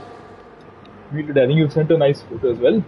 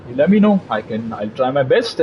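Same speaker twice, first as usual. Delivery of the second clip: ordinary talk, nothing marked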